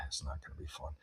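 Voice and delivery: a whispered, sulky aside; whispered gripe. Retold or imitated. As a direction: whisper it like a bored kid who gave up. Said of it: "I'm just like".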